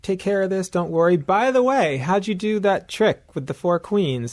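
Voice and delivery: high, friendly sales pitch; smooth lawyer voice. Retold or imitated. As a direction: charm her like a slick attorney. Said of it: "He seems like".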